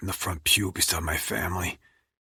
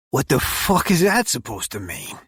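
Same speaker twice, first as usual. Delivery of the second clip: higher, indignant sputter; 6 semitones higher, 4 dB louder than usual. Acted. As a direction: blurt it out offended. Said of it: "he sputters".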